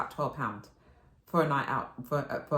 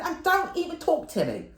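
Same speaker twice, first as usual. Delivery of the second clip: grumpy snap; 13 semitones higher, 4 dB louder than usual. Retold or imitated. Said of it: "He said".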